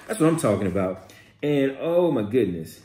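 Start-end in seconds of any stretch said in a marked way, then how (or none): none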